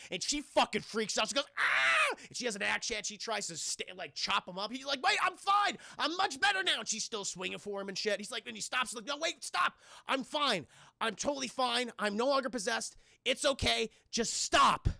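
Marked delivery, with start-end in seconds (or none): 1.6-2.2 high sharp mock scream; hammed-up startled shriek. Annie freaks out. 5.0-14.9 raised, hurried pleading; mock frantic begging. Ash pleading while axe swings.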